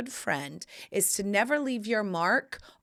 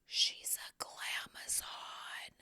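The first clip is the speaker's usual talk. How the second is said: slow, hushed stage whisper; awed whisper; 14 dB quieter, much slower than usual. Retold or imitated. Neighbor seeing the glamazon visitor, whispering in awe. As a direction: whisper it slowly, dumbstruck.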